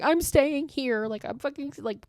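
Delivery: put-on crying voice, pitched high; mock tearful. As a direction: imitate someone too scared to move, pleading through tears. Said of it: "and through her tears".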